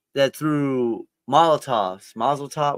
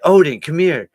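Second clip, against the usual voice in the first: calling the dog, raised voice; 4 semitones higher, 6 dB louder than usual. Retold or imitated. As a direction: call across the house to the dog. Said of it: "when I yell".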